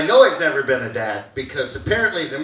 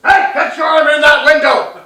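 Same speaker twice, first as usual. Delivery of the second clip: loud, raised mock-dad scolding; dad's warning; 7 semitones higher, 6 dB louder than usual. Dad warns son about car window, loud scolding. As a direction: call out a father's stock caution. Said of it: "just like my dad".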